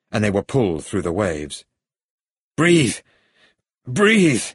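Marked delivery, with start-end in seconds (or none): 2.5-4.4 high, loud shouted command; urgent shout. A narrator's reading. Hero to companion dragged through waves, urgent shout. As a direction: shout it to keep someone alive. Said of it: "shouted Bond".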